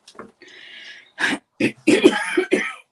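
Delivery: long rasping cough. Real, not acted. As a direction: cough through it. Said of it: "horrible taste in my mouth".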